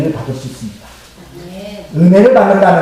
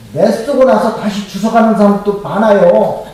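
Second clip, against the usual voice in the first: ordinary talk, nothing marked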